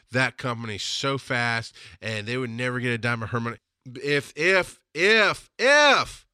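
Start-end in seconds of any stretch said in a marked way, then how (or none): 3.9-6.3 each if louder and higher, ending in a shout; building louder if. Shown as a demonstration. To his mom, each if louder and higher up to a shout. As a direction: ramp the if up to a yell. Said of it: "I got louder to make it a big capital if".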